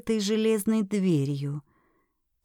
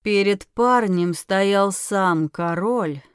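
ordinary delivery, nothing marked

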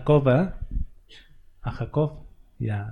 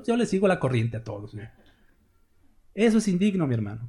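ordinary talk, nothing marked

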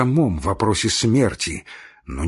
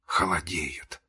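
very soft, hushed word; whisper; 3 semitones lower, 9 dB quieter than usual. A narrator's reading. Marya, feeling the dying man's feet, whispering. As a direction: drop to a hushed near-whisper. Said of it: "прошептала она".

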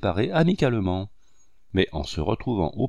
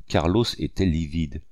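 ordinary delivery, nothing marked

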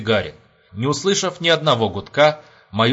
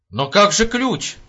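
high, slow dismayed question; exclaiming; 9 semitones higher, slower than usual. A narrator's reading. Captain reminds the major about the key, exclaiming. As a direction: cry out in concern. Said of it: "воскликнул капитан".

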